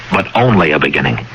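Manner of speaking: ordinary talk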